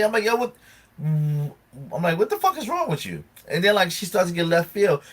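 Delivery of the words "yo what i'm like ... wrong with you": much higher, incredulous pitch; exasperated retort. Retold or imitated. Retelling his comeback to a friend's tirade, exasperated. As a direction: protest in baffled exasperation.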